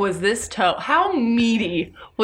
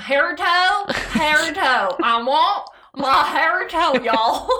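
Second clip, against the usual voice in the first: higher-pitched hammed-up Southern drawl; slow Southern twang, playful; Southern accent; 5 semitones higher, much slower than usual. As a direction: do a cartoonish Southern monster. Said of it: "it was the Southern accent".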